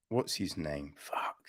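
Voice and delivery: breathy self-muttering aside, trying to remember; whispered aside. Real, not acted. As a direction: whisper to yourself, trying to recall a name. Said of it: "forgetting a name, muttering".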